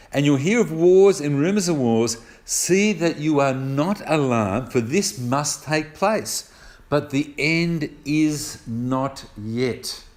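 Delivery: measured verse reading, slowing to a weighty close; solemn scripture reading. Real, not acted. Preacher reading a warning verse to the congregation, solemn and deliberate. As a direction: read it out firmly, then draw out each word.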